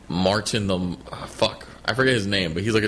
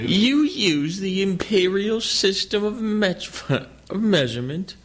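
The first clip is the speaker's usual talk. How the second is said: slow, condescending mock British accent, higher; pompous British dude; 8 semitones higher, slower than usual. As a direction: play the pompous Brit looking down on them. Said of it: "your British dude".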